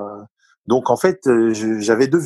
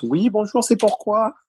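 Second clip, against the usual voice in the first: much higher, imitating a puzzled staff member at the door; mock doorkeeper greeting; 11 semitones higher than usual. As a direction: play the surprised person answering the door, voiced high. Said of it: "quand ils ouvraient la porte".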